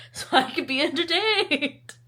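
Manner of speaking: high, loud, bursting out after a laugh. Real, not acted. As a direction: laugh your way into a loud punchline. Laughs at own morbid wish.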